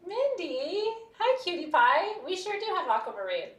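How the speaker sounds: high, loud and delighted hello; singsong cutesy welcome; gushing greeting. Real, not acted. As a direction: greet a favorite customer with a squeal of affection. Greeting a customer by name on stream.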